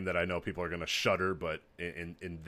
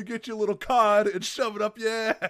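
put-on higher, a thick New York tough-guy accent; 12 semitones higher, 5 dB louder than usual. Heel wrestler taunting Ace Austin in a New York accent. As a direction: insult him like a brash New York heel. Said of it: "[name] with the New York accent".

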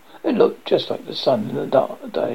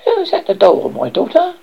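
raised, high-pitched storybook king; questioning king; 8 semitones higher, 5 dB louder than usual. A narrator's reading. King asks daughter at dinner, raised questioning voice. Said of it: "asked the king".

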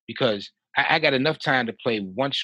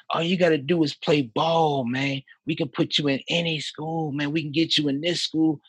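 hammed-up pleading coach, pitched higher; imitating assistant coach; 6 semitones higher, 3 dB louder than usual. Assistant coach begging player to keep playing, mock pleading. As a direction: coach pushing hard, rising urgency. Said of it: "imitate his voice best I can".

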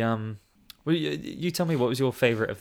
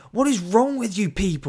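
high, louder mock fuming; 11 semitones higher, 8 dB louder than usual. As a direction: mimic the furious actress lashing out. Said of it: "she's just fuming".

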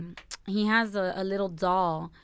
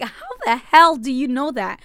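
shocked; 4 semitones higher, 6 dB louder than usual. Retold or imitated. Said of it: "And I'm like".

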